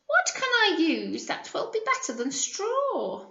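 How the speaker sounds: high, wondering little pig voice; second pig wondering. Acted. Teacher voices the second pig pondering for the class, high. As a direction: ask it like a curious piglet.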